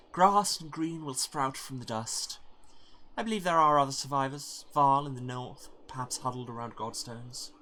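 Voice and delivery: wistful hopeful hero, softening toward the end; hopeful character voice. Acted. Voicing a character.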